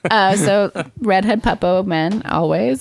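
ordinary talk

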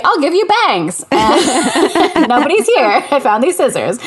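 put-on high-pitched mischievous child, drawing out the offer; scheming kid. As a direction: play a kid who found scissors. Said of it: "you're the neighbor's kid".

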